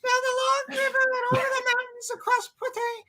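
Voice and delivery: very high, fast helium squeak. Shown as a demonstration. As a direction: talk like you inhaled helium. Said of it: "like you have Helium".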